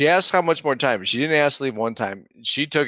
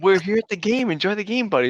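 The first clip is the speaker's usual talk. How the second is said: put-on high-pitched mild protest to a kid; retold dad reasoning, pitched up high; pleading dad; 8 semitones higher than usual. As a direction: coax your son in a higher voice. Dad pleading with son in story.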